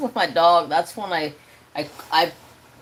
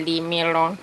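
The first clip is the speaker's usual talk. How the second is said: cat voice. Pretend. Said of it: "You just say".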